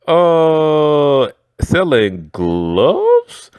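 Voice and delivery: slow, drawn out fake confusion; playing dumb. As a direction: stretch "selling gloves" in mock innocence.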